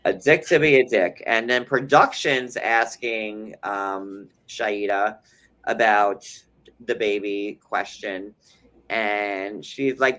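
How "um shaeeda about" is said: ordinary talk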